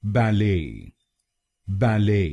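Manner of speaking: बैले joined as ba-LAY; weight on the "lay" of बैले; American बैले with silent T; American pronunciation. Shown as a demonstration. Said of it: "तो बनेगा".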